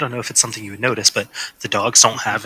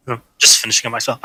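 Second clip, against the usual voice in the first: normal talking voice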